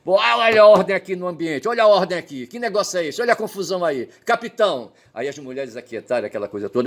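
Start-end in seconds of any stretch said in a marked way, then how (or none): 0.0-4.9 high, louder call for order; king shouting for order. Retold or imitated. Solomon calls the quarrelling women to order, raised. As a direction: bark at the room to calm down. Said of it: "o Salomão gritou".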